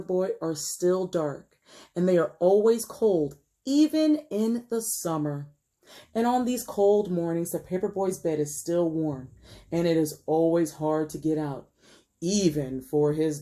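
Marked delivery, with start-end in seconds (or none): none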